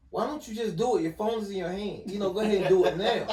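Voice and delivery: mock-annoyed, urging someone to get on with it; retold exasperation. Husband retells nagging his wife to text, mildly exasperated. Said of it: "I used to get mad".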